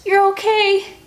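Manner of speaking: high loud burst of relief; relieved joy. Acted. Annie hugging Finn, high excited relief. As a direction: gush with relief. Hugging her found friend.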